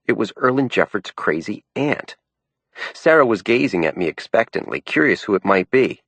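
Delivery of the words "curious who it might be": ordinary delivery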